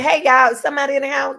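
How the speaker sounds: mock alarmed shout to housemates; calling out. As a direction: call out to everyone in the house. Acts out alerting the household.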